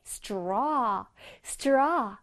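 straw with a drawn-out vowel; long STRAW. Shown as a demonstration. Teacher drilling the first half of strawberry, long straw. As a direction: stretch the aw in straw. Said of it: "straw를 길게 발음해줄게".

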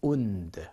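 운드 said as "oond", long oo; wound as OOND. Shown as a demonstration. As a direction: say 운드 slowly like "oond", falling. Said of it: "발음 다시 한번 제가 해드릴게요".

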